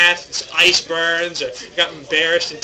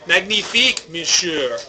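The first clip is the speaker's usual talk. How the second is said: bad, hammy French accent; exaggerated French accent, played for laughs; 2 semitones higher, 5 dB louder than usual. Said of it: "Worst French accent ever".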